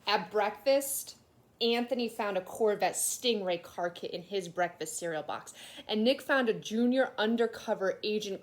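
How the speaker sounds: put-on lively read-aloud to children; storybook reading. A narrator's reading. Teacher reading a picture book aloud to her class, animated. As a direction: tell it like a kid's picture book.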